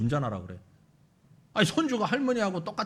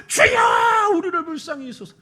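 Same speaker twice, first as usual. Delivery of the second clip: desperate shout; 7 semitones higher, 7 dB louder than usual. Acted. Blind men shouting over crowd.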